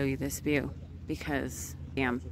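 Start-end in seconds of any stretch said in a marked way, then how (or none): none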